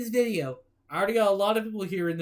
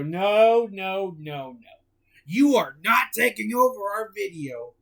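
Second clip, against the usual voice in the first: usual speaking voice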